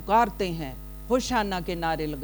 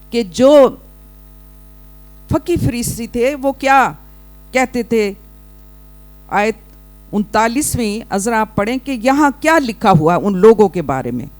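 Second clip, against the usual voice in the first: loud, raised preacher's voice; full-force preaching; 5 semitones higher, 14 dB louder than usual. Real, not acted. Preacher asking the congregation to read the verse, loud preaching. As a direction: ring it out to the congregation.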